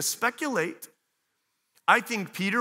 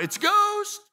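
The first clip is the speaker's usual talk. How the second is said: high frightened shout of ghost; terrified cry; slower than usual. Retold or imitated. Frightened disciples see Jesus on water, terrified cry. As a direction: cry out in terror at a shape. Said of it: "they cried out".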